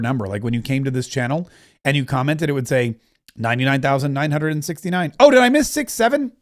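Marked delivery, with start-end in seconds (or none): 5.2-6.3 sudden high oh of surprise; a real surprised yelp. Real, not acted. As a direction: catch that you just missed it. Notices the number too late.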